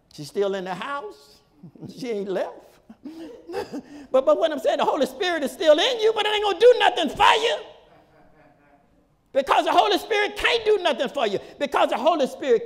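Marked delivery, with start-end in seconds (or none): 4.1-12.6 high, loud, climbing emphasis; preaching shout. Real, not acted. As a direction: drive the point home at full force. Preacher drives home his point.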